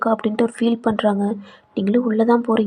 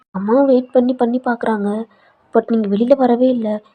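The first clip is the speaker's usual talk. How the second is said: plain reading voice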